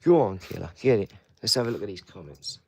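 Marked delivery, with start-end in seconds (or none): 0.0-1.1 loud hyped-up urging; loud play cheer at the dog; egging on. Real, not acted. Egging dog to attack toy.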